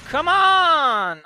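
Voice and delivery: high loud yell; frustrated shout. Real, not acted. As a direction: shout at the stuck car. Yelling at the car.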